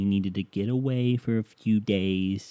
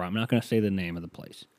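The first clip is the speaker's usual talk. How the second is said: ordinary delivery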